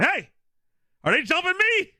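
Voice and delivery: loud, high, frantic yell; dad yelling. Retold or imitated. Dad yelling over to Kevin mid-fight, loud and high. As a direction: holler the question over the fight. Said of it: "Yelled over to Kevin".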